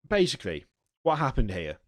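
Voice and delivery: ordinary delivery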